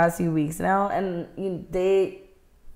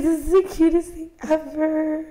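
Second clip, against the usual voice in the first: high-pitched, overcome squeak; exaggerated teary adoration, pitched up; mock tearful gushing; 7 semitones higher than usual. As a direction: gush as if about to cry. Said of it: "I was like".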